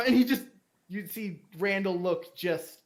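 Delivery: usual speaking voice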